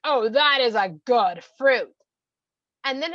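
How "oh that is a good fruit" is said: loud, slow, drawn-out approval; emphatic relish. Acted. Husband has just bitten the fruit, loudly relishing. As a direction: boom it out, stretching every word.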